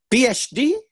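imitating mom, high and loud question; puzzled mother. Mother with little English questions her son's degree, puzzled. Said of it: "so she kinda says".

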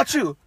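mock sneeze, "achoo"; spoken-out pretend sneeze; acted sneeze. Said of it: "He's like".

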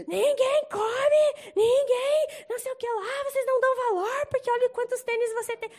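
high, loud mock rant, dragging the first words; neurotic rant. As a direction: play a frazzled missionary scolding everyone. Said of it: "bem louco e neurótico, falando". (desabafo neurótico, agudo e alto, imitado)

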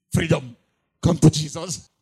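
shouted plea to come to Jesus; loud altar call. Real, not acted. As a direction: call out to the whole crowd. Preacher's altar call to listeners.

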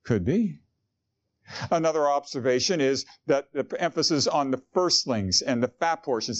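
ordinary talk

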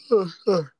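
high breathy sobbing gasp; crying child sobs. Acted. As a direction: whimper like a small crying child. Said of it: "I was doing my crying child impression".

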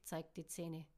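usual speaking voice

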